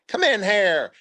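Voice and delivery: imitating the first sergeant. First sergeant calling a passing soldier into his office, high mock call. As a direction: mimic the old first sergeant summoning you.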